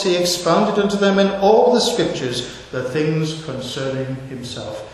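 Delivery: usual speaking voice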